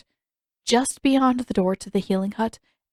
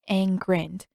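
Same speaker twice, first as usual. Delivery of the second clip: ordinary delivery, nothing marked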